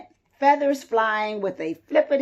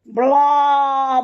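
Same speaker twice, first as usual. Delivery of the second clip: louder, far bleating blah; goat bleat; 3 semitones higher, 5 dB louder than usual. A narrator's reading. Narrator bleating as Goat at the half-gone sun. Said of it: "bleated the Goat".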